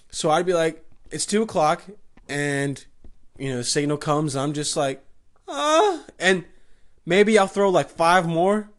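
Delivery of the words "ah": high, breathy fake moan; mock sex moan. Performing a paid fake moan for a lonely inmate at night. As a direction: let out a hammed-up moan. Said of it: "I'm making sex moans".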